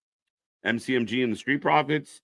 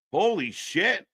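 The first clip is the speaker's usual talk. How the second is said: usual speaking voice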